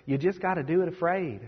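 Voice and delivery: softly imitated thick accent; Sadie Robertson. Preacher echoes a young speaker's advice, imitating her thick accent softly. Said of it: "I'm sorry if anybody sounds like that".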